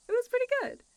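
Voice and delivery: delighted laughing compliment; laughing praise. Real, not acted. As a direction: squeal it through laughter. Praising listener's impression, still laughing.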